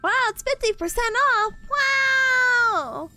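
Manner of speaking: high delighted wow; thrilled by a bargain; excited. Real, not acted. Finds sale on store page.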